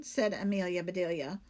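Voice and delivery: plain reading voice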